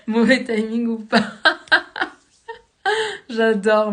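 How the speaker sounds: laughing while reading the comment; amused, delighted laugh; en riant. Real, not acted. Réagit au commentaire de Marine. (rire sincère et ravi)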